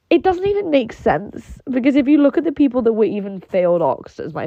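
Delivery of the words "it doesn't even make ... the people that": exasperated. Real, not acted. Exasperated rant about her ancestry.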